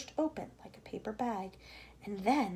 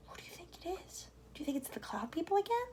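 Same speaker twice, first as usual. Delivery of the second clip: frightened whisper; 7 semitones higher, 8 dB quieter, faster than usual. Acted. Travellers whispering in fear of the cloud people. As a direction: breathe the question in fear.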